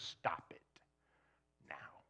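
quiet, breathy, firm stop it now; firm hushed command. Retold or imitated. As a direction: say it softly but with finality. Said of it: "His answer is".

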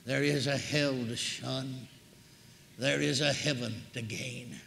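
low, soft, slow closing appeal; solemn appeal. Real, not acted.

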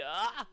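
short, high loud yelp; startled yelp. Real, not acted. Gamer dizzy from spinning gets surprised, yelping. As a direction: cry out suddenly.

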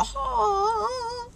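high, far-off mock wail; mock cry. After the movie, fake crying at its end. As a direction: pretend to be moved to tears. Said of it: "wanna go".

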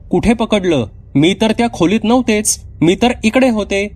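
put-on high, playful child voice; a young girl gloating she wasn't caught; little girl teasing. Girl teasing her mother she was never caught, playful.

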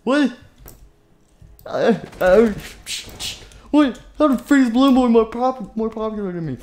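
high, loud outraged shriek; can't believe the kid beat him; hammed-up jealous shock; shocked outrage.

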